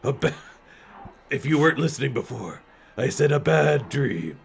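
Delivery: goofy put-on monster voice; dumb monster voice. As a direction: play the dim monster girl. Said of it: "this dumb monster voice".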